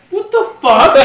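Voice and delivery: high, loud laughing disbelief; comic appalled outburst; mock shocked dad. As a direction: blurt it as the horrified dad. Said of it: "the dad saw it and was like".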